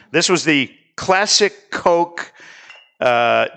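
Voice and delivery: ordinary talk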